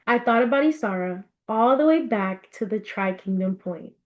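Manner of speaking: ordinary delivery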